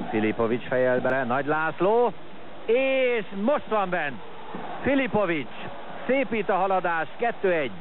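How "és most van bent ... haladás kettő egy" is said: excited sports commentary. Real, not acted. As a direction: ring out the goal. Goal call excitement.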